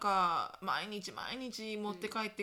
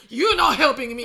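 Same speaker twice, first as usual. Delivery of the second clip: much louder snap back, laughing; loud laughing retort; 17 dB louder, slower than usual. Retold or imitated. Mother yells back at the helper, loud and laughing. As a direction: shout it back half laughing. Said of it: "みたいな".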